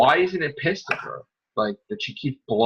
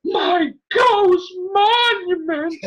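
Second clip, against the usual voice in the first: hammed-up cry; much slower than usual. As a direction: wail it like an overacted sob. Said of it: "mocking character in episode".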